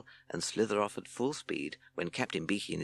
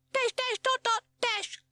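very high squeaky bat signals; squeaked code; 4 dB louder, slower than usual. A narrator's reading. Bat signalling from the sky, high squeaks. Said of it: "he squeaked".

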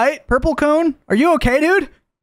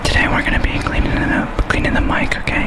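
ASMR whisper. Pretend. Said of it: "Welcome to my A M S A S M R stream".

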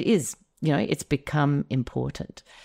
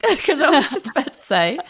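laughing; 12 semitones higher, 6 dB louder than usual. Real, not acted. Laughs in surprise.

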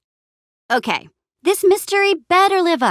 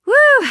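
high, loud whoop; excited whoop; 13 semitones higher, 7 dB louder than usual. Acted. Adventurer thrilled by the chase, excited whoop. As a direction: let out a whooping cheer.

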